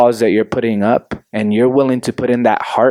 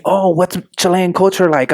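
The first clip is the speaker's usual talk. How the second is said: higher eager question; mock curious asker; 7 semitones higher than usual. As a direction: ask it like a nosy acquaintance. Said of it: "people would ask me".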